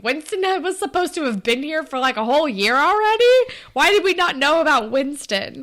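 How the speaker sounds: disbelieving, pushing the pitch up; incredulous. Retold or imitated. Incredulous reaction to retcon.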